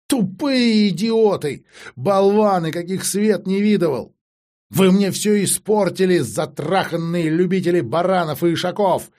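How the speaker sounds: high, loud, despairing outburst; furious tirade. Acted. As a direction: rail at them, drawing out the insults. Acted furious tirade by Alisanda.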